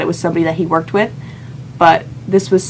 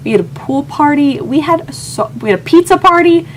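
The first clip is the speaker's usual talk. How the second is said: excited gushing; 8 semitones higher than usual. Real, not acted. Telling viewers about the Chicago trip, building to a high excited squeal. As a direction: build up the delight line by line.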